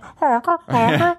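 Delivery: high squeaky honking; clown honk. Pretend. Imitating clown nose honk.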